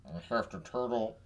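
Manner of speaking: put-on turtle-like politician voice; Mitch McConnell. Host showing his idea of a Kentucky accent, senator impression. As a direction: do a comic Kentucky senator impersonation. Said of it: "the Mitch McConnell".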